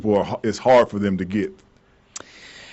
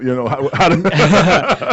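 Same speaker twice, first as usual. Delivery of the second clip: slow, laughing through it; cracking up mid-question; 6 semitones higher, 5 dB louder, slower than usual. Real, not acted. Joking about gang signs.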